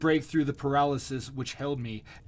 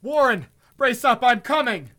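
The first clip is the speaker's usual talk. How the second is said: much louder, higher resolute shout; 10 semitones higher, 11 dB louder than usual. A narrator's reading. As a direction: shout it down to him bravely. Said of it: "then shouted a resolution".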